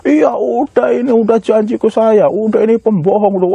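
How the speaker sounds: high-pitched mock tirade of an upset wife; imitated angry outburst. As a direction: act out her furious accusation, pitched well up. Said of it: "Wah, ngamuk istrinya itu". (menirukan istri yang ngamuk)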